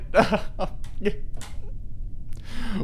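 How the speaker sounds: laughing it off after the crash; airy laugh in disbelief; high breathy laugh. Real, not acted.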